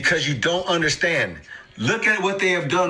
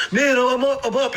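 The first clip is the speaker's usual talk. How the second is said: mock excited; 8 semitones higher than usual. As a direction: crow like a giddy winner. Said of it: "You excited over the last twenty-four hours".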